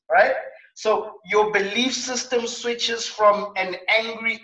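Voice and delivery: ordinary delivery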